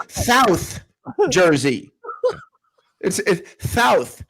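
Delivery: "South" said as "Thouth"; lisped South. Retold or imitated. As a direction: lisp the S of "South" into th, drawn out. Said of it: "It's T H O U two H".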